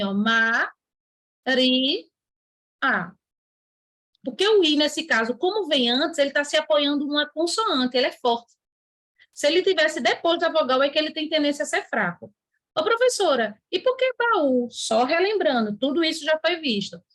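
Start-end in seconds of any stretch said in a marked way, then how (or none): 0.0-3.1 "ma, ri, a" as three separate beats with pauses; split into syllables. Shown as a demonstration. Teacher showing the class how Maria splits, beat by beat. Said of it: "Maria se separa assim". 12.8-14.7 raised, eager student asking the teacher; imitating a student's question. Teacher voicing a pupil asking her about baú, raised eager tone.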